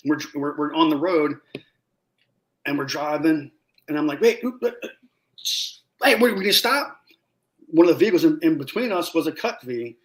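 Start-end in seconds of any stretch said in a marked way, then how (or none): 4.2-7.0 alarmed surprise. Retold or imitated. Surprised at convoy not stopping.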